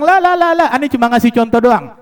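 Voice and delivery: loud, high rush of objection; urgent protest. Retold or imitated. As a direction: call out high to halt it at once. Saint hastily stops the moving mountain. (seruan mencegah, keras dan melengking)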